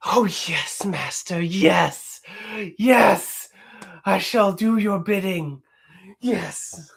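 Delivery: high fawning servant voice, whisper to cries; Renfield. Pretend. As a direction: grovel eagerly to your master. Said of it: "can you do a line or a scene".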